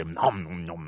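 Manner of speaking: low, deep monster munching; Cookie Monster nom nom. Retold or imitated. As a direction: chomp it like a hungry monster. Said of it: "Cookie Monster qui fait".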